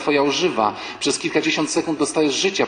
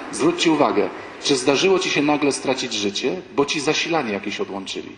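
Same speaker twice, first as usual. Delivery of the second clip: ordinary talk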